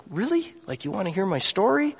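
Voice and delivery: high, soft, incredulous question; taken aback. Retold or imitated. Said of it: "Jake is just taken back".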